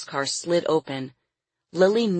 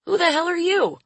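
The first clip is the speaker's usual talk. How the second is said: much higher, astonished exclamation; startled old man; 13 semitones higher, 3 dB louder, faster than usual. A narrator's reading. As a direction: blurt it out, pitch leaping up. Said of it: "The man startled and spun around".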